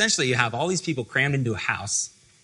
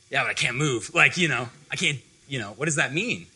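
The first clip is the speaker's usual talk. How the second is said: imagined mildly upset protest, a touch louder; a little upset; 3 semitones higher, 5 dB louder than usual. Pretend. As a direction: push back, puzzled and mildly put out. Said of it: "I would be a little upset".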